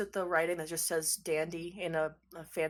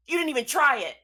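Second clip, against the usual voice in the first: high, loud shout; raised-voice scolding; exasperated yell; 6 semitones higher, 9 dB louder, faster than usual. Acted. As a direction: yell it in disbelief through a door. Voice from behind the door.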